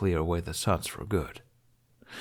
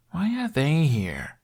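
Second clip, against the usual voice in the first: slow, higher whiny mutter under the breath; 7 semitones higher, slower than usual. A narrator's reading. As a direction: grumble it with a whine, higher and slow. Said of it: "Hiccup muttered".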